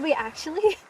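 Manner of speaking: usual speaking voice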